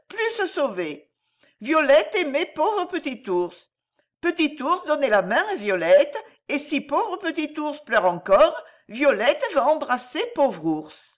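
put-on high, small childlike voice, comforting; a toddler soothing a crying friend; little girl voice. Little Violette comforting the weeping bear boy, high childlike voice.

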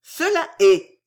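high, loud, slow assertion; hard emphatic confirmation; harsh, blunt. A narrator's reading. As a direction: state it roughly, allowing no doubt. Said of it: "dit Fausta rudement".

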